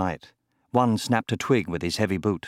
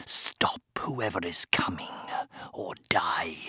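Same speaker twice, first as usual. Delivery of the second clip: harsh whispered command to hidden henchmen; menacing whisper; 4 semitones lower, 8 dB quieter, slower than usual. A narrator's reading. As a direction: whisper it as a deadly threat. Villain whispers orders before an ambush.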